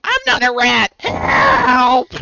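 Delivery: loud, high shouted rat voice; high-pitched rat scream. Acted. Game master repeating the rat's cry, loud high scream. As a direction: shriek it like a panicking rat. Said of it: "pretend I'm doing my high-pitched voice".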